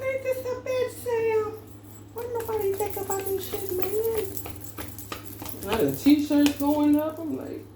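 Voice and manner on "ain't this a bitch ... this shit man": high, strained comic squeal of exasperation; exasperated squeak. Retold or imitated. Shopper complaining to Sam about Walmart, squeaky high exasperated voice. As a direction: squeak it out like you can't believe it.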